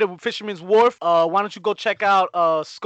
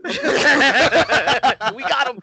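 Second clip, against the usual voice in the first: laughing through the words; 6 semitones higher, 5 dB louder, much slower than usual. Real, not acted. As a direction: chuckle it out. Laughing at the bait.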